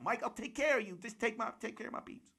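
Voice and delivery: high-pitched hype-man impression; high voice, falling away at the end. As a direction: rattle off orders in a high put-on voice. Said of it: "That was a good [name] voice".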